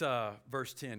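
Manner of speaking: ordinary talk, nothing marked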